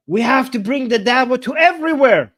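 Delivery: high, loud imitation of a preacher; mock rallying cry. Streamer relaying a preacher's slogan to viewers, mock rallying cry. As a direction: call it out like a crowd speech.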